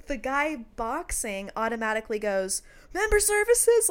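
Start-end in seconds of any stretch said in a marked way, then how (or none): 2.9-3.9 high, loud shout for backup; imitating a worker's yell; yelled call. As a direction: call it out across the store. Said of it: "like, yells really".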